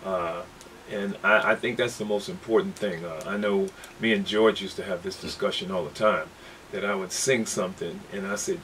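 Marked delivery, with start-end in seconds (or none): none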